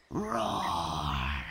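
long roar dropping low; pretend T-Rex roar. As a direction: roar like a playful dinosaur.

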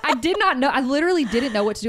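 ordinary talk, nothing marked

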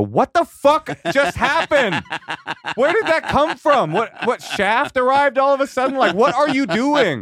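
baffled laughing outburst in a high voice; incredulous brother. Retold or imitated. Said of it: "he go".

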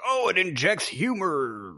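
high, swooping mock wonder; mock headline gush. Host quoting every website's headline, mock-gushing. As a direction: imitate gushing headlines, pitch gliding up and down. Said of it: "it was like".

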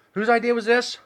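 high, loud outburst; exasperated shout. Real, not acted. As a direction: yell it in pained disbelief. Burning mouth, exasperated outburst.

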